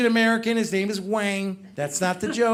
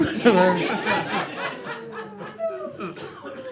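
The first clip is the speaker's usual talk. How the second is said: laughter; 12 semitones higher, 4 dB quieter than usual. Real, not acted. Crowd laughs at impression.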